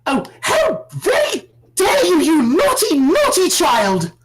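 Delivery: high prim scolding granny voice; scolding old lady. Acted. Missus Claus scolds the player.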